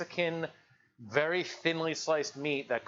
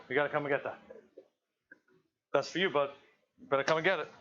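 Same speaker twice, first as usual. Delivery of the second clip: ordinary talk, nothing marked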